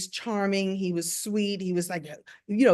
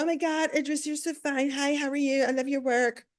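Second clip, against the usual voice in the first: gushing female fans; 6 semitones higher than usual. Retold or imitated. Women walking up to Idris, mock gushing. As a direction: mimic starstruck women fawning. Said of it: "all women walk up to him and go".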